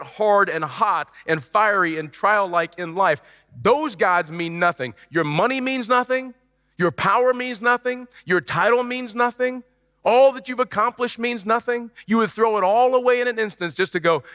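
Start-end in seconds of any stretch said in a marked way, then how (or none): 5.1-11.9 each line pitched high and hammered home; preaching emphasis. Acted. Preacher to congregation, raised high and emphatic. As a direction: drive the list up, insisting it's worthless.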